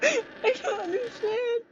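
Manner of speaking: high, near-tearful whine; whiny bafflement. Real, not acted. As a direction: wail it, helpless and confused. Baffled and failing in game.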